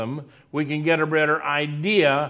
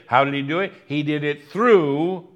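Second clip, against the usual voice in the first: normal talking voice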